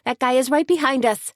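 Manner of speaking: high, urgent shout; urgent yell. A narrator's reading. Tony in the car urging Suzanne to outrun the Jeep, yelling. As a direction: yell at the driver to hurry. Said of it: "Tony yelled".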